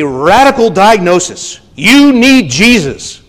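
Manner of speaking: loud, fervent preaching; preaching at full force. Acted. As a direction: preach it with fire. Preaching climax.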